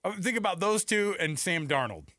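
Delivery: normal talking voice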